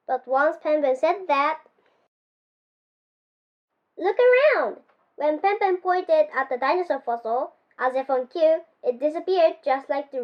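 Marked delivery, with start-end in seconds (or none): 4.0-4.8 higher pitched, urgent look around; excited call. A narrator's reading. Pepin calls the friends to look, excited. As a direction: point and call out to the others.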